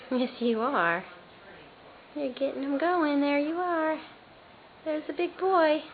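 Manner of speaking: usual speaking voice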